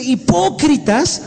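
high, slow reproach of hypocrites; Hipócritas stretched high and slow, then sinking; rebuking cry. Acted. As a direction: draw it out like a prophet's rebuke. Preacher quotes Jesus' rebuke.

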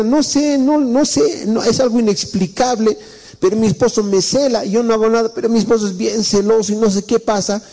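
a wife pleading she did nothing; complaining wife. Retold or imitated. Esposa se queja del marido celoso. (esposa quejosa con voz muy aguda)

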